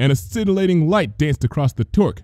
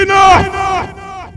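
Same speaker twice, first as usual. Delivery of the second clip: high, loud roaring command; 5 dB louder, slower than usual. A narrator's reading. Luna cutting off Trixie, roaring. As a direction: roar it to silence her. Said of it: "Luna roared".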